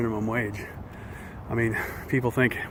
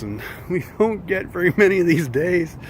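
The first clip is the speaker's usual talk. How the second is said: ordinary delivery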